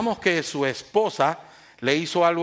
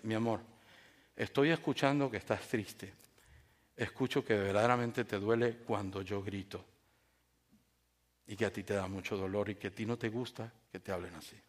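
soft, low, tender reflecting back; attentive listening; 7 semitones lower, 9 dB quieter than usual. Acted. Wife reflecting husband's sadness back, gentle and understanding. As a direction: show calmly that you heard the hurt. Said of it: "Ahora, escuchar atentamente".

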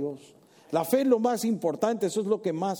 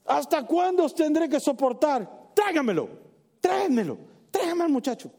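exasperated Jesus; 9 semitones higher than usual. Acted. Preacher voicing exasperated Jesus.

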